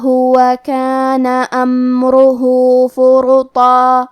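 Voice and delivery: slow chanted tajweed recitation; slow murattal chant; Quran recitation. Shown as a demonstration. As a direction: recite melodically, stretching the long vowels.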